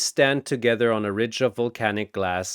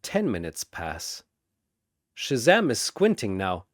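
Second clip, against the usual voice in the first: ordinary delivery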